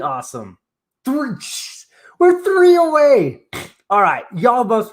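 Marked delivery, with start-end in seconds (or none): 1.1-3.4 high, loud burst of excitement; can barely contain the thrill; shouting the countdown; excited. Real, not acted. Streamer three subs from 2000.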